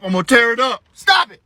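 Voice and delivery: rising to a high, loud snap; threaten, then burst out sharply; exasperated dad losing patience; angry scolding. Retold or imitated. Threatening mouthy child.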